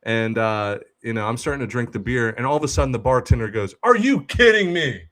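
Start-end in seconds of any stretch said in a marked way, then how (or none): 3.8-5.0 high, loud disbelieving cry; shocked outburst. Retold or imitated. Bartender finds the customer gone, loud disbelief. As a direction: blurt it out in shock.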